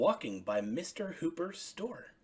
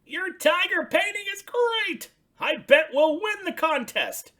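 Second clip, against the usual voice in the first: high, loud excited Big Bird; Big Bird praising the painting; 12 dB louder than usual. Acted. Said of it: "shouted Big Bird".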